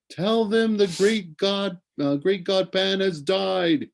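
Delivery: slow, high, calling out from far away; a ghostly voice carrying over the water; mysterious crying voice. A narrator's reading. Voice from the shore calling to a sailor, slow and high. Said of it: "a mysterious voice crying out from the shore".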